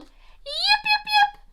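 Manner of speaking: very high, loud little yips; a little fox calling out across the hill toward the music; fox yipping call. Acted. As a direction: call out like a small fox into the night. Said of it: "and she called".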